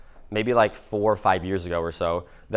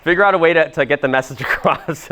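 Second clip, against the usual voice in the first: giggling, louder and higher while rephrasing; laughing through words; 6 semitones higher, 4 dB louder than usual. Real, not acted. Coach laughing at his own awkward word choice, giggly. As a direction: laugh at own slip as you go on. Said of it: "that's a horrible word to use".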